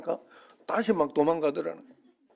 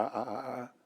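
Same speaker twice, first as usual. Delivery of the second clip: ordinary talk, nothing marked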